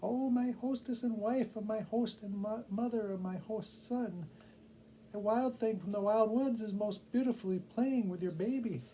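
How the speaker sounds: excited tiny bat bringing gossip; high squeaky bat. A narrator's reading. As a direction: play the little bat, eager with news.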